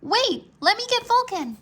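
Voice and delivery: high, loud urgent call. A narrator's reading. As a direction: call out to stop him. Rory calls after them.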